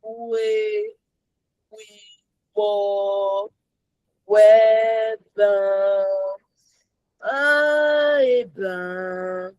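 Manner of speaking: long drawn-out, monotone words; unenthused hedging. Acted. Believer whose enthusiasm is fading, drawn-out and reluctant. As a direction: let each word drag out without zeal. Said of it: "Quand je commence à être bon".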